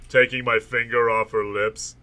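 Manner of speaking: usual speaking voice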